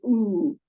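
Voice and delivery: Oo said short and punched as if hit in the belly; a punchy demo of the oo sound; a clear oo vowel for the class. Shown as a demonstration. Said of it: "makes the oo sound, like you're punching your belly".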